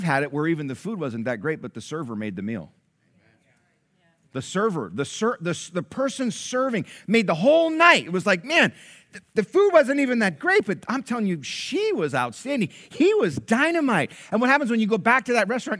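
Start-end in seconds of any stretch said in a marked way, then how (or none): 4.3-15.8 high, loud, fervent preacher's build; full-force preaching. Acted. Pastor firing up the congregation about a great server, loud preaching. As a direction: preach it at the top of your voice, pushing higher.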